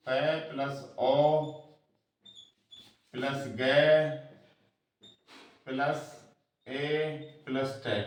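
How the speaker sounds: पे, ओ, गे, ए, टे as separate sounds with long pauses; sounded out piece by piece. Shown as a demonstration. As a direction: break it into single sounds joined by plus, from पे to टे. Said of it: "इस तरह ये बोलेगा।". (पे से टे तक हर ध्वनि अलग-अलग, रुक-रुककर)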